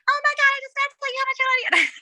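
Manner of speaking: high, fast fangirl gush; star-struck fan pouring it out; breathless gush. Retold or imitated. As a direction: blurt it out all at once. Fan gushing to [name].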